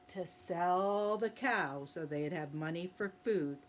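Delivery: ordinary delivery, nothing marked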